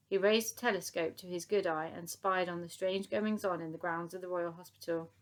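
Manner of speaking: usual speaking voice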